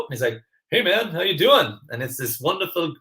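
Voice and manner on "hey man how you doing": put-on Canadian accent. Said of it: "gorgeous Canadian voice that I've... impersonate".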